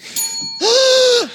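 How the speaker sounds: long, high gasp; dramatic gasp. Acted. Customer still staring in the mirror, dramatic gasp. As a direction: overdo a high intake of breath. Acted reaction to new haircut.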